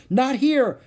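high, loud frantic warning; warning shout. A narrator's reading. Annie waving off the wildebeest, warning shout. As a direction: shout to turn them away. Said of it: "she shouted".

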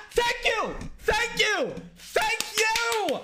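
very high, mock-grateful thank you; triumphant thanks. Real, not acted. Agreeing with a video that slams season one: high triumphant thanks. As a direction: squeal your vindication.

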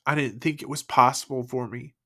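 holding back real sobs; crying. Real, not acted. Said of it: "I'm, I'm crying".

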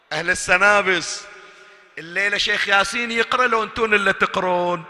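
loud, driving pulpit call; rally the crowd from the pulpit; full-force preaching. Acted. خطيب يخاطب الجمهور بحماس. (خطابة منبرية بكامل القوة)

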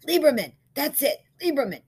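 high, loud burst of relief; excited recognition. A narrator's reading. Man remembers the store at last, excited. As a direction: seize on the remembered name.

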